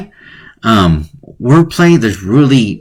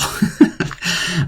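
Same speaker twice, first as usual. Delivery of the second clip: silent chuckle; 7 semitones higher, 7 dB quieter than usual. Real, not acted. Laughs at own joke.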